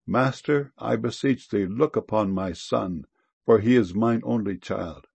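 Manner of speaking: ordinary talk, nothing marked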